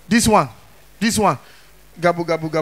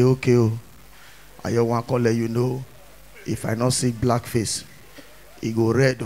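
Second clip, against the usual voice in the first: much lower, softer, quiet threat; low menacing thug; 14 semitones lower, 10 dB quieter than usual. Retold or imitated. A thug quietly pressing the MC about the act.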